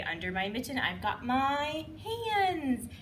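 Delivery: slow, drawn-out high hands; storytime reveal. Shown as a demonstration. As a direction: stretch the reveal. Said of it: "we say".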